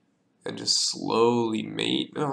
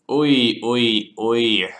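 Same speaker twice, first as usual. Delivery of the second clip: loud, groaning oys of frustration; exasperated oy; 8 dB louder, much slower than usual. Real, not acted. Streamer stuck on a chess puzzle, exasperated. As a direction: let out a fed-up groan, louder.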